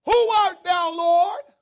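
high, loud cry to heaven; startled, full-voiced plea; Saul answering the Lord. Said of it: "And I answered".

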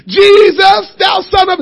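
high, loud desperate call; screaming again. Retold or imitated. Blind Bartimaeus ignoring the crowd, a loud high cry. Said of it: "and he kept on going".

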